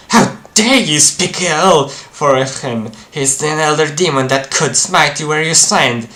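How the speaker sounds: high, loud, affronted zealot; indignant demon devotee. Acted. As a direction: scold them for insulting the elder demon.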